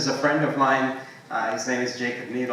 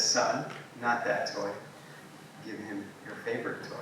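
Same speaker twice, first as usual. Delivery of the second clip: quietly firm, easing softer at the end; gentle firm father; 3 semitones lower, 9 dB quieter than usual. Retold or imitated. Father in a story quietly telling his son which toy to give, gently firm.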